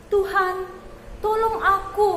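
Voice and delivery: high, loud kneeling plea; begging plea. A narrator's reading. Mother kneeling at Jesus' feet, high loud begging. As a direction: beg at his feet.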